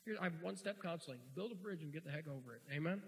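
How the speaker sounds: ordinary talk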